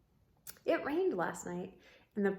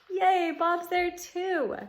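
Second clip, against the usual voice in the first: high, loud happy cheer; delighted; 8 semitones higher, 6 dB louder, slower than usual. Real, not acted. Reader delighted to see Bob, high and loud. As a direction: cheer with a laugh.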